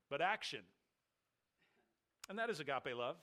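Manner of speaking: ordinary talk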